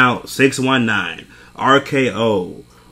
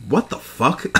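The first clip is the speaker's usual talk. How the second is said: laughing disbelief; 6 semitones higher, slower than usual. Retold or imitated. Retold reaction with laugh.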